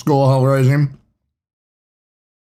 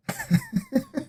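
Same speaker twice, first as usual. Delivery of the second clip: high laugh; 10 dB quieter than usual. Real, not acted. Laughs at reply.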